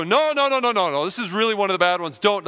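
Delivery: the accuser pleads in a panic; protesting enemy. Acted. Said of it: "the enemy's back to go".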